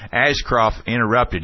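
ordinary talk, nothing marked

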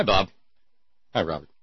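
normal talking voice

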